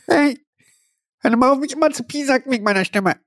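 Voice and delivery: high squeaky protest; high-pitched voice. Acted. High put-on voice protesting teasing.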